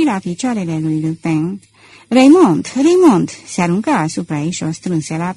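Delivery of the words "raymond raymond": loud, higher desperate calling of her name; a lover calling to the woman who fell; anguished cry. Acted. Lupin calls his dying beloved.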